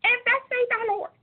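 high squeaky preacher voice on "And thus says the Lord"; Minnie Mouse voice. Retold or imitated. Mocking her own early preaching recordings in a high squeaky voice. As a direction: squeak it out like a tiny cartoon mouse. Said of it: "I sound like Minnie Mouse".

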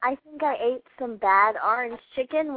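ordinary talk

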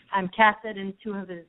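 normal talking voice

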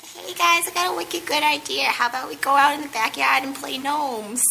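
put-on high little-kid Boston voice, "wicked good"; childhood Boston accent. Retelling being a kid, high Boston accent. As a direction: play his kid self in thick Boston. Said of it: "speaking like that".

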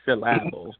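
"syllable" slowed, stressed on the middle, sih-LAB-ul; misplaced stress. Shown as a demonstration. Joking about wrong emphasis, deliberately misstressed word. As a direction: lean wrongly on the second beat of "syllable".

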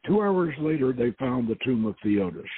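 usual speaking voice

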